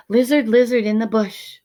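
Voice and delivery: brisk calling out; called quickly. A narrator's reading. Alexander calling the lizard into the bush quickly. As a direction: call to the lizard, hurried. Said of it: "he called quickly".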